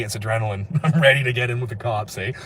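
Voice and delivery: ordinary talk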